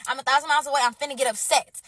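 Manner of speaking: usual speaking voice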